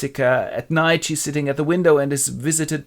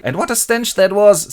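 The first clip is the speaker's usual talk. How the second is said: high, loud put-on complaint; Lucy's mother; 8 semitones higher, 6 dB louder than usual. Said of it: "said Lucy's mother".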